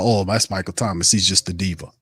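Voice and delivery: low, soft, sneering mock quote; a hint of a dismissive critic. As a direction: lightly imitate the doubters with a scoff. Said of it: "they probably wanna pretend".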